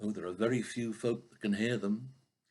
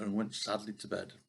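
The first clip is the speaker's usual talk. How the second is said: ordinary delivery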